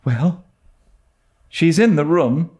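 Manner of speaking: put-on high, breathless young girl telling news; a giddy girl half thrilled, half scared; Diana, excited girl. A narrator's reading. Diana to Anne, breathless and high. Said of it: "said Diana".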